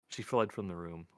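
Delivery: ordinary delivery, nothing marked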